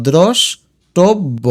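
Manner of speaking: দ্রষ্টব্য slowly as drosh, tob-bo; broken into parts. Shown as a demonstration. Teacher tells students to break it up, দ্রষ্টব্য slowly split. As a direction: pause after the first syllable of দ্রষ্টব্য. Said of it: "আর ভেঙে দেখো".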